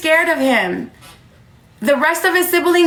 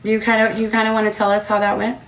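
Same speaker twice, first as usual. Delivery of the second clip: coaxing mom; 5 semitones lower, 3 dB quieter, faster than usual. Real, not acted. Mother coaxes daughter to tell story.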